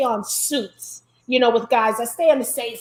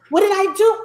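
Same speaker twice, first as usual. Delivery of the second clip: higher and louder, alarmed; panicked server; 6 semitones higher, 6 dB louder than usual. Retold or imitated. A nervous waitress fearing a complaint, alarmed. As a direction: blurt it like you fear you're in trouble. Worried server reacting to demand.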